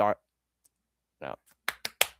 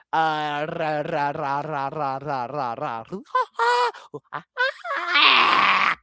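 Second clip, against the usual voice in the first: silly chant building to high laugh; 4 semitones higher, slower than usual. Pretend.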